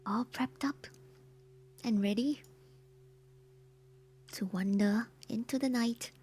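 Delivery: whispering. Real, not acted. Whispered Halloween stream intro.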